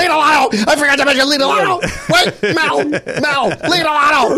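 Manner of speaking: frantic high shouting, laughing; George Costanza. Retold or imitated. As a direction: George yelling after Mel in a panic. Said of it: "And then George goes".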